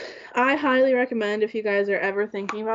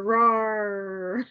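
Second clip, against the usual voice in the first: cute little bear roar; playful bear roar. Pretend. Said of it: "I'm a bear".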